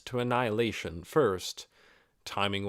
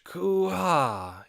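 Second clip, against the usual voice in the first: big sleepy yawn, pitched up; huge yawn; 9 semitones higher, 4 dB louder than usual. A narrator's reading. Tired lizardman yawning in front of the gate, huge yawn. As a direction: let out a huge yawn despite himself. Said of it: "He yawned a huge".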